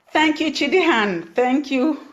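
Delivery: high, loud, delighted thank-yous; bright thanks. Real, not acted. Host thanking live viewers, high and delighted. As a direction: beam at the viewers as you thank them.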